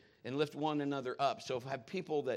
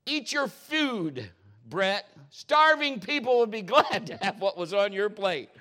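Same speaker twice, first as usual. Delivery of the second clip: scolding mom; 6 semitones higher, 8 dB louder, slower than usual. Retold or imitated. Mom voiced scolding son at dinner.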